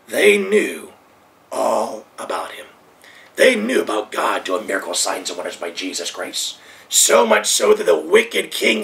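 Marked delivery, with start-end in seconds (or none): 1.5-6.6 low and hushed. Real, not acted. Preacher drops voice low.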